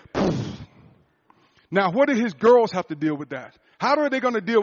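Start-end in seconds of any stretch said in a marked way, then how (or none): 0.1-0.4 breathy puff for a gunshot; mouthed "poof" gunshot noise; imitated gunshot. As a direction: puff out the sound of a shot. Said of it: "and went".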